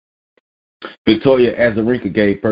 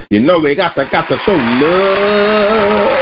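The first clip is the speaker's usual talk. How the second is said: drawn-out high call; 11 semitones higher, slower than usual. Acted. Drawn-out singsong ending.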